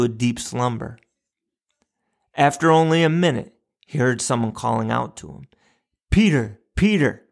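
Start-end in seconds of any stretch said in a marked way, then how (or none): 6.1-7.2 higher, mild storybook call. A narrator's reading. Old woman calling to the sleeping man, mild higher call. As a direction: call his name as if from a distance. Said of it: "cried the voice".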